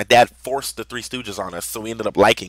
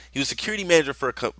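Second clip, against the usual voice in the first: plain reading voice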